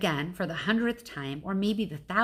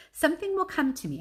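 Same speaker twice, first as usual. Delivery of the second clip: Ivan hopeful; 9 semitones higher, faster than usual. Acted. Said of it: "I say at last".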